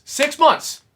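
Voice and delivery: jumps high and loud on six months; indignant emphasis. Retold or imitated. Fan recalling his six-month wait, jumping high and loud. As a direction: stress the wait, outraged. Stressing how long he waited.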